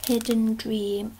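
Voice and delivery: ordinary delivery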